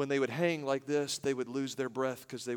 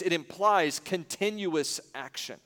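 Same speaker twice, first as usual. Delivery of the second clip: ordinary talk, nothing marked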